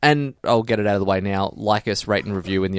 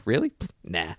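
dismissive; 8 dB quieter than usual. Retold or imitated. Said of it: "love the dismissive tone of it".

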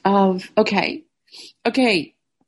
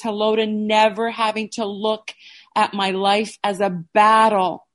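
louder, firm, hopeful reading; letter read aloud; 2 semitones higher, 3 dB louder than usual. Real, not acted. Reading her hello letter to her new life, firm and hopeful. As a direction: declare each hello like a fresh start.

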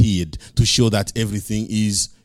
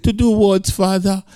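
higher, puzzled son asking; a son's startled question, pitched up; voice of Jesus; 9 semitones higher than usual. Pretend. Preacher voicing Jesus questioning his Father, higher and puzzled.

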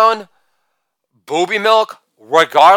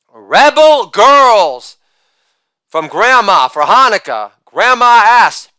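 ordinary talk, nothing marked

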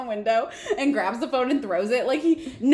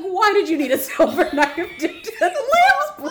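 high, loud protest cracking into laughter; mock incredulous; 8 semitones higher, 5 dB louder than usual. Sam baffled by Dean's explanation, incredulous. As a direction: demand an answer in comic outrage.

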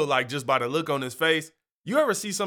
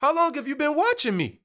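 alarmed surprise; 10 semitones higher than usual. Retold or imitated. Realising the neighbor has been watching him, high startled alarm. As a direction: catch him spying and recoil. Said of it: "I'm like".

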